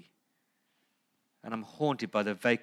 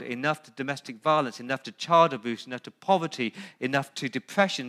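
full-force preaching; 2 semitones higher, 7 dB louder than usual. Acted. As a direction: drive the list to a shouting peak. Second enough list, stronger.

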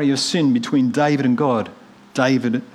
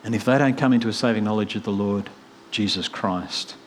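ordinary delivery

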